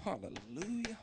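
ordinary talk

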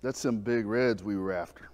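a quiet determined aside to the wife; hushed excitement. Retold or imitated. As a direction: fisherman quietly vowing to his wife. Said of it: "I was like".

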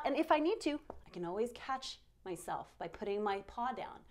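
ordinary talk

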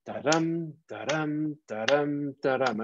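"da-dum" chanted evenly, weight on "dum"; forward-moving iambic rhythm. Shown as a demonstration. Teacher lets students detect the forward motion, iambic rhythm on "da-dum". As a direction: repeat "da-dum" as short-LONG beats, stepping down at the end. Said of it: "begin to detect a rhythm".

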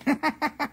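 short high giggle; laugh. Real, not acted. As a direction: break into a high, quick chuckle. Amused by ruined eagle story.

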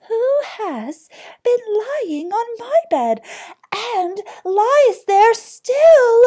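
very high, loud little bear; astonished tiny bear, piping high and slow at the end; teeny tiny astonished voice. A narrator's reading. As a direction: squeal it in tiny amazement. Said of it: "in a teeny, tiny voice of astonishment".

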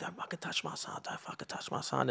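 usual speaking voice

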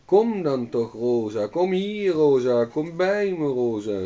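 loud, high calling by name. A narrator's reading. Old hag loudly calling the cow Rosa to her. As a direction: call the cow over. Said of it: "riep ze".

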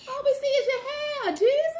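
loud. Real, not acted. Complaining about close-up.

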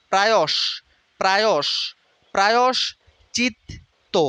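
প্রায়শ্চিত্ত stretched very slowly, pra-yosh-chit-to; drilling প্রায়শ্চিত্ত for students; slow syllable drill. Shown as a demonstration. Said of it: "উচ্চারণের মধ্যে চলে আসে".

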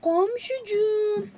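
slow, stretched honeyed call; drawn-out teasing my dear neighbor; coaxing call. Acted. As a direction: sweeten it to lure him. Luring the neighbor.

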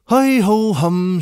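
high, slow sing-song sigh; mock cheerful sing-song hiding a sigh. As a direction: brush off the worry cheerfully. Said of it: "cheerfully repressing a sigh".

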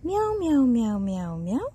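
playful human meowing; cat imitation. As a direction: mimic a chatty kitty.

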